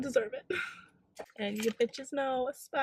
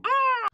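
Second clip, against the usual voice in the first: short high, loud scream; frustrated scream; 8 dB louder than usual. Real, not acted. Dog mom venting over a dog in a car, frustrated scream. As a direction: let out a sharp high yell of frustration. Frustrated outburst during rant.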